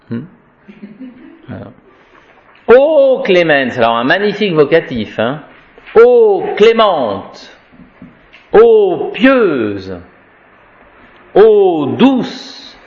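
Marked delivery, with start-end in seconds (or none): 2.7-3.8 high, slow, declaimed; solemn invocation. Shown as a demonstration. Lit. prière déclamée. 6.0-12.8 solemn invocation. Shown as a demonstration. Lit. prière déclamée.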